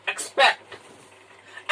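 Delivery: एक्सपेक्ट said very slowly, every sound drawn out; slow careful demo word. Shown as a demonstration. Teacher to pupils, spelling out a word slowly. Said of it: "दूसरा है".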